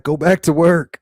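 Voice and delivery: hammed-up husband telling her to get back to work; mock husband. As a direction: bark the advice like a clueless husband. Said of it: "He's like".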